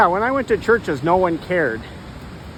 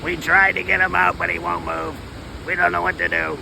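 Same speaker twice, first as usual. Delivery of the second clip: less femmy voice. Acted. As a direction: speak as the squatting voices, lightly. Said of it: "One that's not so, uh, femmy".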